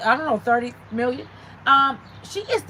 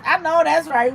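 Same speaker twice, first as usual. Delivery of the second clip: ordinary talk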